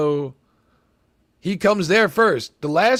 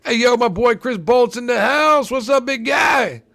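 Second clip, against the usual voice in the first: loud, higher hype shout to a buddy; excited greeting; 6 semitones higher, 6 dB louder than usual. Retold or imitated. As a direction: call out to Chris Bolt like he just walked in. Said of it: "I'm like".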